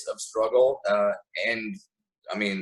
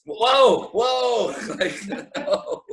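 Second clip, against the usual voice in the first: pitched way up, laughter creeping in; mock shock; 12 semitones higher, much slower than usual. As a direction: cry halt in playful alarm. Mock objection to being called racist.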